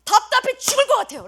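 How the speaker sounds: high-pitched plea to God; frustrated pleading prayer. Acted. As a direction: beg for an answer as a worn-out believer. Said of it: "라고 말하고 있는 사람들에게".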